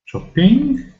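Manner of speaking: Shopping? with a big questioning rise; wondering if Shopping has a suffix. Shown as a demonstration. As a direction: ask Shopping? with a high rising tune.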